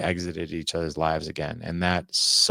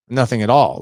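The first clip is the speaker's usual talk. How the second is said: much louder, higher, emphatic; firm refusal; 4 semitones higher, 9 dB louder than usual. Retold or imitated. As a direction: slam the door on the idea. Refusing to talk to dad.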